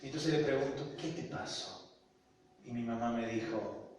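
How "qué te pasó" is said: soft, barely voiced question; retold gentle probing; hushed question. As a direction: ask it almost in a whisper.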